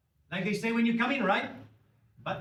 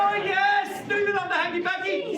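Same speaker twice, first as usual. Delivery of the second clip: very high, loud crowd hype; rousing shout; 10 semitones higher, 6 dB louder than usual. Real, not acted. Comedian getting the crowd to answer, excited shout. Audience answers, he hypes them further.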